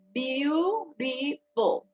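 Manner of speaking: Beautiful drawn out very slowly, each syllable apart. Shown as a demonstration. Teacher draws out Beautiful slowly to count syllables. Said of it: "We have three syllables here".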